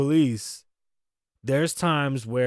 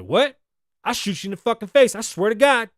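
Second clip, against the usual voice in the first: a higher, louder retold yell of a threat, rushed; mock furious threat; 7 semitones higher, 6 dB louder than usual. Imitating the officer screaming at the woman, louder and higher. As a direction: bark it out like the enraged officer.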